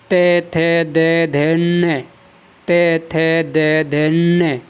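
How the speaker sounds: का, खा, गा, घा, ण recited in a measured row; syllable drill. Shown as a demonstration. Teacher reciting the ट-row to show the nasal at its end. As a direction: chant the row slowly, landing on ण. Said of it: "इसी प्रकार आप इसमें देखेंगे".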